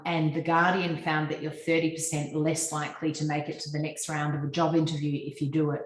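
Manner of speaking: usual speaking voice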